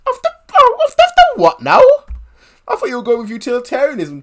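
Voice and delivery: very high confused squeak; baffled high voice. Acted. Character squeaking in disbelief at the British line.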